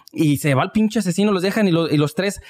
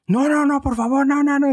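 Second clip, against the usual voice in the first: mock pleading scream; 10 semitones higher than usual. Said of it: "grite y grite".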